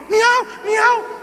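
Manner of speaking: high imitated meows; cat meowing. The cat comes to help the dog, high meowing. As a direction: meow like a little cat.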